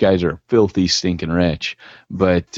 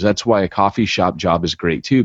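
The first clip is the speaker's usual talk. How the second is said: usual speaking voice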